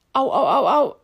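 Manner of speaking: pained little ows; wincing ow ow ow; heartburn pain. Real, not acted. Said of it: "warum hab ich plötzlich Sodbrennen?".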